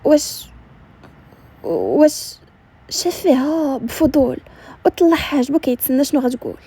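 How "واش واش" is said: halting, tentative start; uneasy false starts with long pauses; nervous hesitation. A narrator's reading. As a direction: start to ask, then falter. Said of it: "ياسمين شافت فيه بتوتر".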